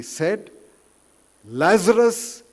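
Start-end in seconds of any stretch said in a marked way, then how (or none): 1.4-2.4 high, loud calling of the name. Retold or imitated. Preacher voicing Jesus at the tomb, loud call. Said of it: "in a loud voice he said".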